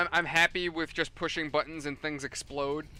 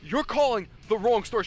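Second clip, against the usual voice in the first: moderately raised, higher-pitched yell; retold yell; 5 semitones higher, 4 dB louder than usual. Store worker re-enacting yelling at a customer, raised voice. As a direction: re-enact yelling at a caller, not full force. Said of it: "I actually yelled at her".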